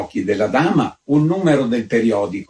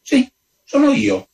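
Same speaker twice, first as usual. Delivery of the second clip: a proud countess, much higher; 3 dB louder than usual. A narrator's reading. Countess proudly admits she writes as Nirvana. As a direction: say it with haughty pride. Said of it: "fece la contessa con fierezza".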